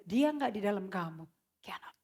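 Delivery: breathy whispered warning. Acted. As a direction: drop to a hushed whisper. Preaching point dropped to whisper.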